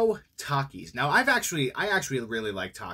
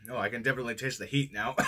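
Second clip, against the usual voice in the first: coughing after spicy chip; 3 semitones lower, faster than usual. Real, not acted. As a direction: hand over mouth coughing. Seen 0: Fist at mouth while coughing; no chewing clearly visible then.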